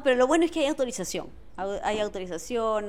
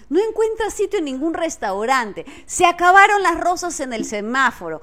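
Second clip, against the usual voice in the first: louder, higher heated rant; 5 semitones higher, 7 dB louder than usual. Real, not acted. Host ranting to viewers about the crowded streets, fired up. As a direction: vent about the Valentine's chaos. Ranting against Valentine's outings.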